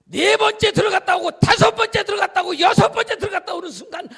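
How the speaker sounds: loud, high chanted count; preaching shout. Real, not acted. Preacher counting Naaman's dips to the crowd, ringing shout. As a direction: build the count to a climax.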